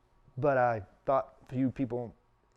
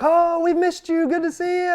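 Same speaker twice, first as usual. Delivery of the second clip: mock gushing greeting; 12 dB louder than usual. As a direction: gush like overjoyed church folk, hammed up. Mimicking gushing greetings from church people.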